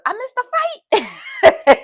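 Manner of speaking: loud, high, drawn-out comic wail; mock dismay. Child realizing she missed the class fight, mock dismay. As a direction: play up the outrage, slow and very high.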